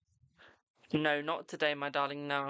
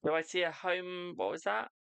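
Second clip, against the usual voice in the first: ordinary talk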